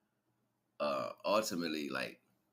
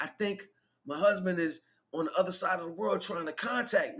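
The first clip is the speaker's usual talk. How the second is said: mock wife voice; 12 semitones higher, 4 dB louder than usual. Wife voiced in retold movie.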